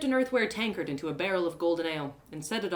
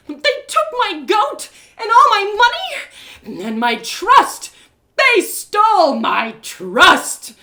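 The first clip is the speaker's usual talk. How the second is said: loud, higher, outraged ranting, slowing at the end; furious shout; 12 semitones higher, 14 dB louder, slower than usual. Acted. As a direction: rage at him over the stolen goat and trust. Confronts the robbers' son angrily.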